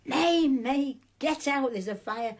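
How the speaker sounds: high, urgent retold shout; shouted warning. Supervisor warning May of the fire, shouting. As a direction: call out loudly to raise the alarm. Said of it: "she shouts to me".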